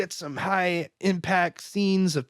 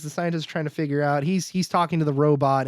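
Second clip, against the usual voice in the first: ordinary talk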